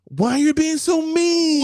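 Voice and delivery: high, loud, drawn-out whine; mock whine. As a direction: stretch it into a hammed-up wounded complaint. Mimics argument over being mean.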